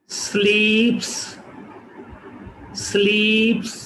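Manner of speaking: स्लीप्स slowly, plain p; slow unaspirated p. Shown as a demonstration. Teacher models स्लीप्स carefully. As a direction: say स्लीप्स without puff. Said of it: "next example".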